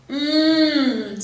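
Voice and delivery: loud delighted hum; a loud happy yum; savoring mmm. A narrator's reading. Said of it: "said Camilla".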